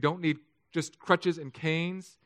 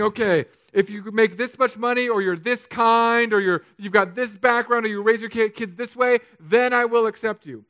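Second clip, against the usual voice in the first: loud, high-pitched preacher's delivery; preaching; 7 semitones higher, 7 dB louder than usual. Acted. Pastor tells congregation they weren't asking God, forceful preaching.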